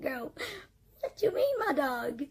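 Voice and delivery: baffled retort leaping high in the middle; confused. Retold or imitated. Asking the teacher what she means, confused. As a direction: question her in mock bewilderment. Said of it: "I was so confused".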